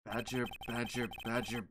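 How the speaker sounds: low, soft, unimpressed voice; weary investigator. Acted. Investigator puzzles over silly Badgers, low and weary. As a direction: grumble it low to yourself.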